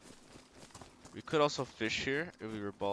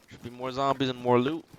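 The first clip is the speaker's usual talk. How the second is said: excited; 3 semitones higher, 11 dB louder than usual. Real, not acted. Eager for loot in next town.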